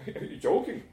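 high, incredulous question; appalled disbelief. Acted. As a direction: challenge them in disbelief. Appalled at leaving the cow.

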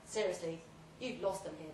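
ordinary delivery

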